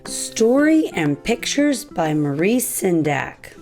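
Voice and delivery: ordinary delivery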